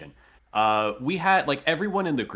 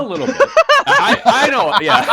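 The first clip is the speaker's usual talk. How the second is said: laughing; 14 dB louder than usual. Real, not acted. Embarrassed laughing reply.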